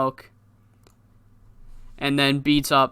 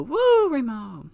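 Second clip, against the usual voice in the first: high, drawn-out crowd woo; hammed-up fan cheer, slow and falling away; mock cheer; 10 semitones higher, slower than usual. Fan cheering Remo after his attack, mock high cheer.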